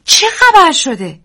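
high, loud, slow scared question; frightened. Acted. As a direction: ask in panic. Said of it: "دیزی هراسان پرسید". (پرسش هراسان، بلند و کشیده)

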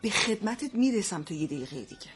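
low, hushed warning; quiet threat. Acted. Mother promising to deal with cheeky son, quietly threatening. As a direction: drop the voice low and soft to threaten.

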